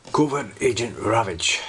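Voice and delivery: put-on weak Russian accent on the name; a faint put-on Russian accent. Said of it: "I'm not very good at doing the Russian accent".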